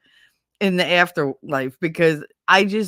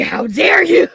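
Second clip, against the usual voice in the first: high, loud shout through laughter; scandalized, laughing yell; mock outrage; 10 semitones higher, 8 dB louder than usual. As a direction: cry out in comic affront. Shocked retort to being called old.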